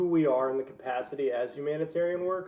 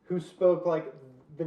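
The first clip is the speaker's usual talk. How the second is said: normal talking voice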